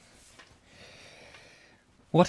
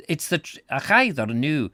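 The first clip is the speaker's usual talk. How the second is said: Scottish accent attempt; 5 semitones higher, 6 dB louder than usual. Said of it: "Just get into the Scottishness".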